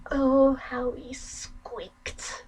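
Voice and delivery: slow, soft, gloating whisper; creepy whisper. Acted. Sister gloating to brother about his dead rat, whispered. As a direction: savour each word in a hush.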